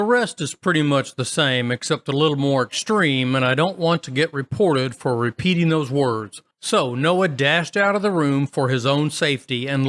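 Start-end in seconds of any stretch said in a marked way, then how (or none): none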